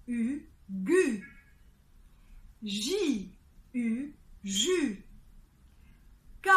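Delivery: each consonant, then "U", then the syllable, with pauses; syllable drill with U. Shown as a demonstration. Teacher leading a consonant plus U drill. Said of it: "on va associer une consonne".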